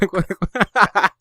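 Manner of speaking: high giggle after disbelief; high laughter. Real, not acted. Laughing at the story.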